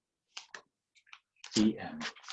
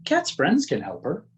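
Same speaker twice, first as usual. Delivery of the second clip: usual speaking voice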